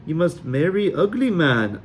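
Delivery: high, broken fake Sully accent; mock Sully fortune teller; teasing fake Sully accent; bad Sully accent. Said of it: "My accent was even worse than Mal's".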